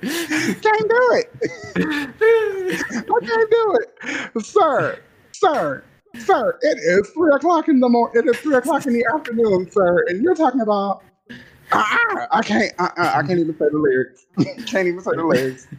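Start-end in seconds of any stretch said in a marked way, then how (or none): 0.0-4.4 much higher, slow, laughing protest. Real, not acted. Mock-scandalized over raunchy songs. 4.4-13.1 higher, sassy mock scolding. Scolding [name] in mock outrage.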